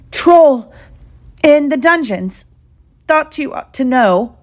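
high, alarmed outcry, loud on the first word; panicked gasp. Acted. Terrified professor gasps a warning to the hall, panicked. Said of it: "slumped against the table and gasped".

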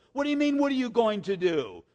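high, loud exasperated mother; frantic mom. Retold or imitated. Mom scolding her calm son, loud and frantic. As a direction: throw it back at him in a panic. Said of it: "she wanted me to freak out".

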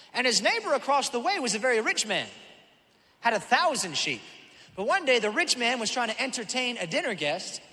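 preaching. Acted. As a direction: raise the stakes as the parable turns. Building the parable.

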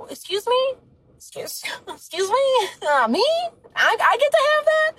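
high, breathless disbelief, laughing; astonished high squeal. Retold or imitated. Telling God she can't believe the blessing, squeaky disbelief. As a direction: act floored by the gift. Voicing her astonished self.

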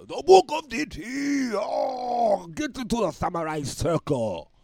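high, drawn-out samurai shout; samurai warrior. Pretend. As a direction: cry out a battle summons like a samurai. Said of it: "like a samurai warrior".

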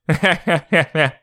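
smug chuckle. Pretend. End of the neighbor impression.

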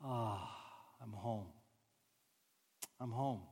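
very soft, low ahh, I'm home; contented sigh of arrival. Shown as a demonstration. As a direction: savor being home. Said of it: "just stop and just say".

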